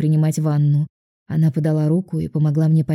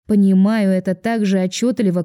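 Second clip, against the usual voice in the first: usual speaking voice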